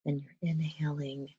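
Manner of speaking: unhurried breath-led guidance, softening as it settles; guided meditation. Acted. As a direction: gently guide them through breathing, leaving long pauses.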